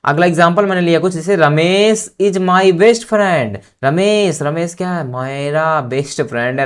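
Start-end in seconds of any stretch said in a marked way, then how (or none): 1.4-3.6 slow dictation. Shown as a demonstration. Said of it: "dictating an example sentence slowly".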